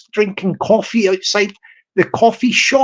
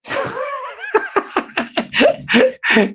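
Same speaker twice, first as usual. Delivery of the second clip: a high, burst of giggling; high giggle; 4 dB quieter than usual. Real, not acted. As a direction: giggle at your own squeaky voice. Laughs at own voice.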